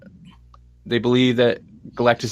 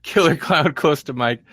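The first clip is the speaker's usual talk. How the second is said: ordinary talk, nothing marked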